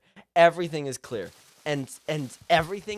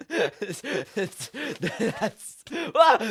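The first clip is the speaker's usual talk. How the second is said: laughing; 7 semitones higher, much slower than usual. Real, not acted. Storyteller overcome after the absurd story, laughing. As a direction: break up laughing mid-word.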